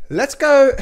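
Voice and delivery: loud, high rallying shout; pumped-up let's go; hype call. Real, not acted. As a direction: fire up the viewers. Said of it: "hype call".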